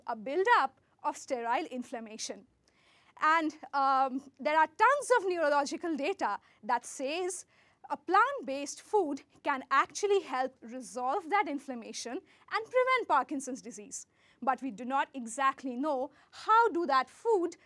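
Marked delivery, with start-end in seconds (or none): none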